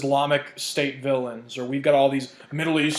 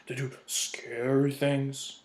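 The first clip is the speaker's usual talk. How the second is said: drawn-out, very slow mock menace; mock spooky; 5 dB quieter, much slower than usual. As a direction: stretch it out like a pretend scare. Mocks the agents' supposed threat.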